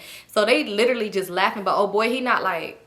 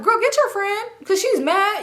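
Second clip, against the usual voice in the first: mocking girl voice; 11 semitones higher, 3 dB louder than usual. Stank mocking, high voice.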